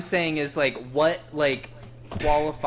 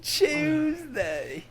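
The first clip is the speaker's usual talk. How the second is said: put-on British accent; 11 semitones higher than usual. British banter continues.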